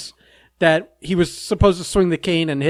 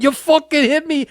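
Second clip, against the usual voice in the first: a lightly put-on Boston accent, higher; 9 semitones higher, 4 dB louder than usual. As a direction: imitate Kevin's angry Boston accent, a touch higher. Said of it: "with that Boston accent".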